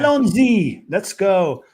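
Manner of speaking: high, loud French flourish on Allons-y; French accent. Retold or imitated. Host quotes Rosner heading off to Qatar, French accent. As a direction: sing out Allons-y like an eager Frenchman. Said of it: "sticking with accents".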